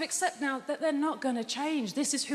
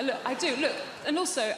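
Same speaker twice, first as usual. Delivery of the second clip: normal talking voice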